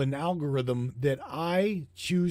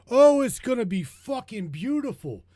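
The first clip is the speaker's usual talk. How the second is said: hammed-up Trump boast, higher and louder at first; lightly voiced Trump gushing; mock Trump; 7 semitones higher, 3 dB louder than usual. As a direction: brag like Trump, fading after the first line. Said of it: "says Trump".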